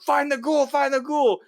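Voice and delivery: high, loud soldiers' shout; shouting knights. Retold or imitated. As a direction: yell the order across the room. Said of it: "and they're like".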